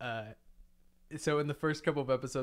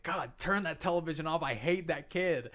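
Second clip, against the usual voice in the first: mock-annoyed parent, slightly raised pitch; exasperated mom; 5 semitones higher than usual. Mom complaining about a cartoon kid, mock exasperated. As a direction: imitate a fed-up mother's complaint. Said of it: "my mom was like".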